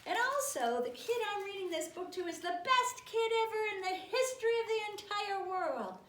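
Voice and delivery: high, loud read-aloud; gushing proclamation. Acted. Book makes reader praise the kid, high loud gushing. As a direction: proclaim it with overblown enthusiasm.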